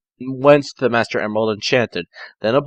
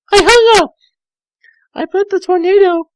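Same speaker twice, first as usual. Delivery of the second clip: put-on much higher, louder girlish narration; high girl voice for Tails; 5 dB louder than usual. Tails narrating the morning in a high girlish voice.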